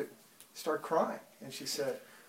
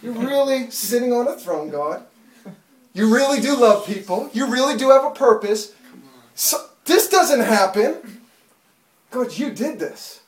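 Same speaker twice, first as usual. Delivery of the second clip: raised, emphatic wonder; awestruck prayer; 6 semitones higher, 7 dB louder than usual. Retold or imitated. Preacher telling God he really reigns, in loud awe. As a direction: declare it loudly up to God, stressing REALLY.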